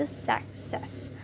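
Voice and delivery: "success" slowly, split as suc-cess; two-syllable demonstration. Shown as a demonstration. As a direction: sound out both syllables of "success", softly. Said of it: "dia memiliki dua syllables".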